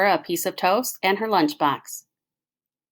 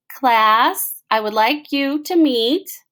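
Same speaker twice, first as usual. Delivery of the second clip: lightly raised formal introduction; announcing; 4 semitones higher, 3 dB louder, slower than usual. Acted. Principal introducing the new teacher, lightly formal. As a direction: present her with ceremony.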